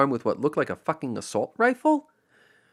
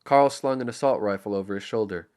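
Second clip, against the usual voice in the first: usual speaking voice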